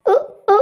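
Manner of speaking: short acted gagging hiccups; choking grunts. Wife has food stuck in her throat, choking.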